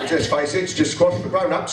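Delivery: normal talking voice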